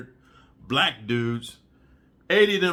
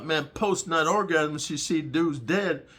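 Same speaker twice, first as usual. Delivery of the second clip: usual speaking voice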